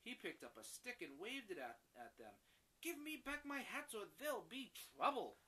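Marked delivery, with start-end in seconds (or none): 2.8-5.4 louder, higher angry threat. Acted. Hat seller waving a stick at the monkeys, angry. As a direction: warn them off with the stick.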